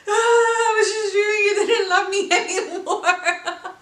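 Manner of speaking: loud, high laugh-crying confession; mock sobbing wail. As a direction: wail half laughing at herself. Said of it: "'cause I was just crying".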